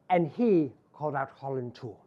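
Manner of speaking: usual speaking voice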